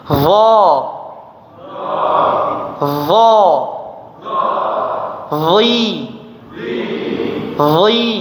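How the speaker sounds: "Dha", "Dhi", "Dhu" said thick and firm; heavy dhad drill. Shown as a demonstration. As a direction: drill each vowel heavy and clear. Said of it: "Kita coba lagi". (ucapkan dhad tebal dan tegas)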